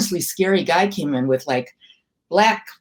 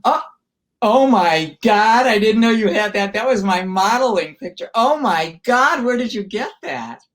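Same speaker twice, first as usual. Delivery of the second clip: real, higher-pitched surprised exclamation; real astonishment; 4 semitones higher, 3 dB louder than usual. Real, not acted. Surprised by her old modeling picture.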